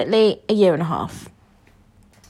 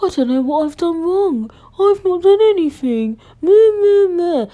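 high, whining fake-innocent voice; mock him with a high whine and meh, meh; whiny playing-dumb man; 10 semitones higher, 3 dB louder than usual. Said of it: "Liddle came on this".

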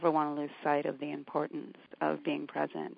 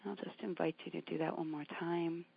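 calm grounding exercise; 6 dB quieter than usual. Acted. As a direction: gently talk them into their breath. Meditation continues.

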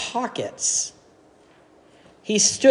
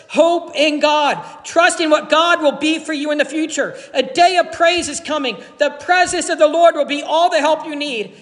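fervent preaching; 7 semitones higher than usual. Real, not acted. Preacher urges hope fervently.

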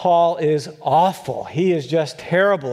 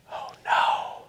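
dreading whisper; 5 dB quieter than usual. Retold or imitated. Students dread Kevin's choice.

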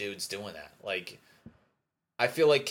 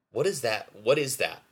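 raised, loud baffled exasperation; exasperated; 4 semitones higher, 6 dB louder than usual. Real, not acted. Podcaster baffled by his own stammer, exasperated. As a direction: demand it of yourself, bewildered.

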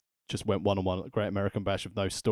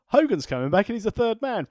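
mock Heenan; 10 semitones higher, 3 dB louder than usual. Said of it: "he might as well have gone".

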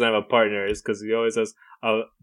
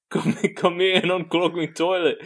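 mock British friend; 6 semitones higher than usual. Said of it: "his friend's also British".

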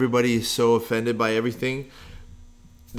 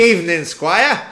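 higher, louder, slow stately greeting; mock formal greeting; 6 semitones higher, 7 dB louder, slower than usual. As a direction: ham up a servant bowing to the Squire. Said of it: "She's gonna be like".

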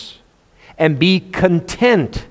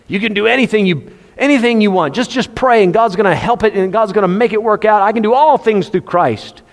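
higher, fast, hammed-up pep talk; mock motivator voice; mock motivational hype; 5 semitones higher than usual. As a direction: parody a preacher selling easy promises. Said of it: "it's a motivator".

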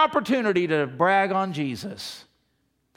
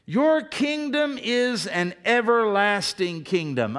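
scripture reading; 3 semitones higher, 4 dB louder, slower than usual. Real, not acted.

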